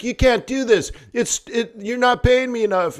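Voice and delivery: a hint of an upset vendor; protesting vendor. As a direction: complain you're not being paid enough. Vendor protests underpaid deal.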